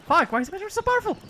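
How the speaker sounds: fast, high frustrated rant; exasperated outburst. Real, not acted. Frustrated outburst mid-game.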